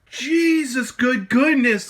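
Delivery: high, loud burst of disbelief; appalled, high-pitched outburst; shocked exclamation. Acted. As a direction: exclaim in big, exaggerated shock. Exclaims over the dirty cartridge.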